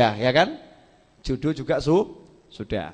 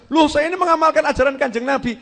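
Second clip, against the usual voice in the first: defensive protest; 9 semitones higher, 6 dB louder than usual. Retold or imitated. Orang membela diri.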